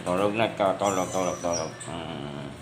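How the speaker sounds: low, soft bedtime murmur; lulling. Real, not acted. Putting the pet to sleep, lulling.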